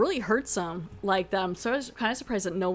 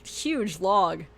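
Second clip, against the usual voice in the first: usual speaking voice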